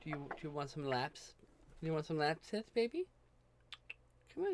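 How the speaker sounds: soft coaxing pet talk. Real, not acted. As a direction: coo at the cat to come up. Coaxing cat onto lap.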